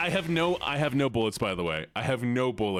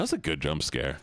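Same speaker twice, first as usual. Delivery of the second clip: voice dropped; 8 semitones lower than usual. Real, not acted. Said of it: "Why my voice dropped like that".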